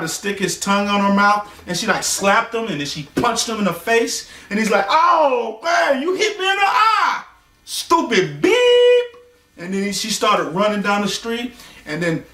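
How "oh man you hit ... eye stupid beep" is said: very high, whining complaint, drawn out; man clutching his eye; hurt, whiny man. Retold or imitated.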